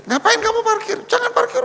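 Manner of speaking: a director yelling at her driver; scolding boss. Retold or imitated. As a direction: forbid the driver to park, angrily. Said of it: "Ngomong, ngomel, marah-marah".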